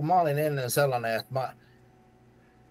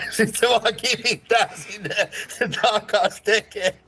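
high laughing retelling; 7 semitones higher, slower than usual. Real, not acted. Trainer laughs recalling the boxer sent back in high laughing tones. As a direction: laugh while telling it.

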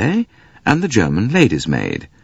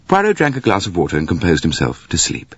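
ordinary delivery

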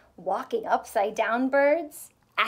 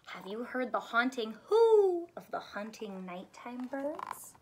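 usual speaking voice

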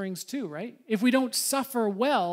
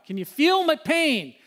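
loud, higher, hammed-up cry for sympathy; mock anguished plea; 7 semitones higher, 7 dB louder than usual. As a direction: play up the grievance, loud and high. Hammed-up appeal to the congregation.

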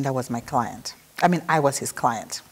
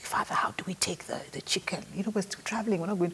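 hushed, half-whispered aside; softly conspiratorial retelling; discreet hushed question; 3 semitones higher, 8 dB quieter than usual. Retold or imitated. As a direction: ask it under your breath, discreetly. Quietly asking Father about chicken.

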